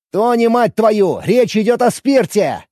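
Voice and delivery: loud, slow exasperated shout. Acted. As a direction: shout to get through to him. Brad yells to cut through.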